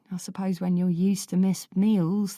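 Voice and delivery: usual speaking voice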